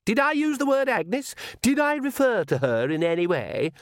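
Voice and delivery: high, haughty questioning; coldly. A narrator's reading. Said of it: "said Lacrimosa coldly".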